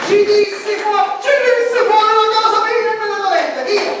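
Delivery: furious yell; angry shouting. Acted. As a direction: shout her out of the house. Son throws the saleswoman out.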